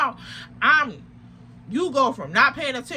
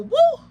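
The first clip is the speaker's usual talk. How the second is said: mock woo; 13 semitones higher, 6 dB louder than usual. Mimicking sibling's rant.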